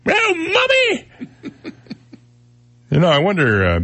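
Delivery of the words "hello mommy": very high falsetto British squeal; comic, high-pitched British greeting; mock British accent. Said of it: "British is my favorite one to do".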